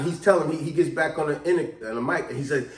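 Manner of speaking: normal talking voice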